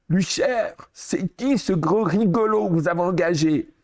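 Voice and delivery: mocking director. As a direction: sneer at the new hire behind the door. Director mocks new hire offstage.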